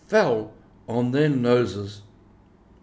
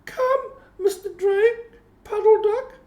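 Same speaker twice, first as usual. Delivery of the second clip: much higher, playful little kitten; 4 dB louder than usual. A narrator's reading. Moppet urging her siblings up the wall, high kitten voice. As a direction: squeak it like an eager kitten. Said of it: "said Moppet".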